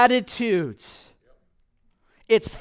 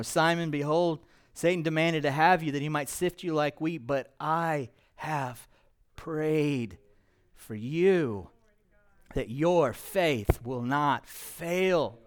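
solemn scripture reading; 5 semitones lower, 6 dB quieter than usual. Acted. Reading scripture words of Jesus.

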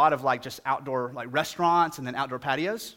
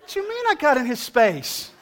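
indignant disbelief; 10 semitones higher, 7 dB louder than usual. Retold or imitated. Indignant reply to dog owner.

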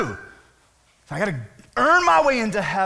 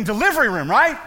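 normal talking voice

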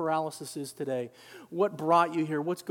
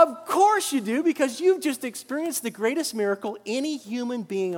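much higher and louder, slowing; excited shout; 6 semitones higher, 4 dB louder than usual. Retold or imitated. Pastor answers Scott, loud and exultant. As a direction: exclaim it with joy.

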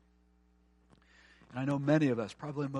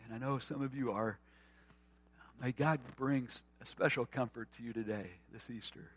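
tender prayer. Acted. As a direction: pray gently over them. Pastor prays over grieving listeners.

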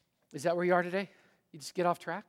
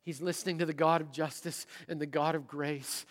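normal talking voice

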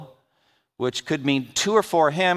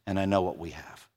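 ordinary talk

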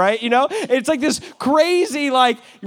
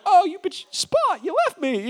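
higher, wounded whine at the dog; mock whining owner; 7 semitones higher, 4 dB quieter than usual. Owner whining after his runaway dog Spot, high mock hurt.

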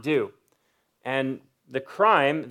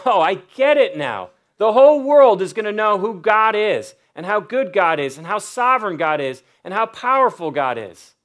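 excited realization; 5 semitones higher, 6 dB louder than usual. Retold or imitated. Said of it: "Daniel was like".

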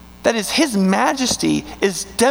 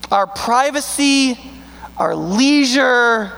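preaching at full force; 6 semitones higher, slower than usual. Acted. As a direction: drive each item home from the pulpit.

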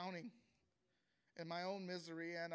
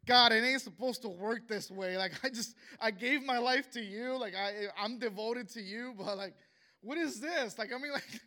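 louder and higher, pleading with God; desperate prayer; 4 semitones higher, 8 dB louder than usual. Retold or imitated. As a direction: cry out to God as if betrayed. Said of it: "getting on my knees and crying".

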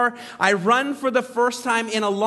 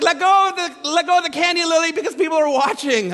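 higher, louder, embarrassed urging; urgent plea; 7 semitones higher, 5 dB louder than usual. Retold or imitated. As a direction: hurry her along in front of people. Dad pleads with stuck daughter.

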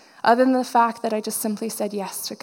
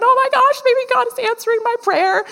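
high, acted-out squeal of hope; excited realization; 12 semitones higher, 4 dB louder than usual. Speaker realizing the check may answer a prayer, excited high voice. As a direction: pitch way up, reliving a giddy realization.

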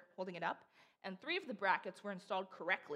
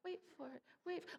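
Aaron Burr; 8 semitones higher, faster than usual. Acted. Preacher imagining a Hamilton moment, playful stage quote. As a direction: echo it like a show tune tease, fading. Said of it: "like Aaron Burr should come on stage".

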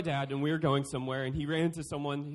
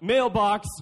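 put-on kid blurt; 2 semitones higher, 8 dB louder than usual. Kid's silly answer.

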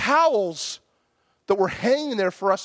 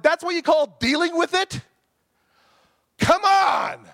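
angry shout; 12 semitones higher, 6 dB louder than usual. Retold or imitated. Outraged at wife scrubbing.

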